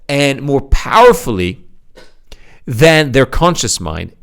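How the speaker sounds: ordinary talk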